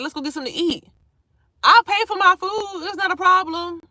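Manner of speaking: high, loud and eager; eager offer. Retold or imitated. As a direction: sell the plan brightly. Eager to meet him.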